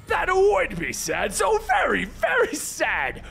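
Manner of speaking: high, drawn-out theatrical ranting; shouting Joker. A narrator's reading. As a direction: rant in mock sorrow and outrage. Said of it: "with the Joker shouting".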